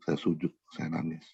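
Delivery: ordinary talk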